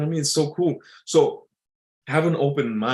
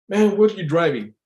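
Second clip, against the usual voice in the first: put-on higher, louder old-timer voice; old-timer quizzing, pitched up and loud; 7 semitones higher, 5 dB louder than usual. Old man at a bar asking a driver, old-timer voice. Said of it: "I had this old timer".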